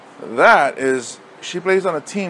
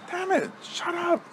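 mock outburst; 7 dB quieter than usual. Coach losing patience.

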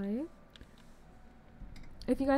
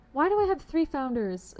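louder, amazed outburst; thrilled shock; astonished cry; 4 semitones higher, 8 dB louder than usual. Real, not acted. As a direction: shout it in happy disbelief. Surprised by rare items.

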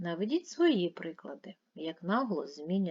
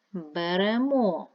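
slow, loud Беремо, held on final -мо; stress on third syllable; 7 dB louder, slower than usual. Shown as a demonstration. Teacher sorting words by stress, third-syllable stress on Беремо. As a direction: stretch the last syllable of Беремо. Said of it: "Третій".

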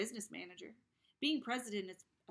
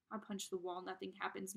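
usual speaking voice

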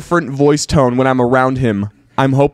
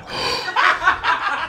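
breathless shocked gasp; 6 dB quieter than usual. Acted. Listener reacts to punchline.

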